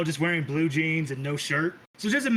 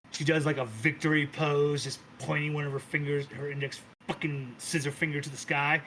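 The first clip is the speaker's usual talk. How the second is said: ordinary talk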